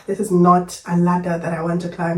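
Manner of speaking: ordinary talk, nothing marked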